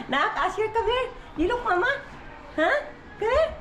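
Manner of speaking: high coaxing call. Real, not acted. Mother coaxing baby Asher to come in a high call. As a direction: call the little one over up high.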